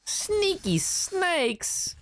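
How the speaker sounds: very high, drawn-out silly cry; silly falsetto, slow and drawn out; playful squeal. Pretend. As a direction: stretch each word out in a high squeal. Playful cry at sneaky opponent's move.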